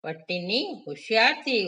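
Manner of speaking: ordinary delivery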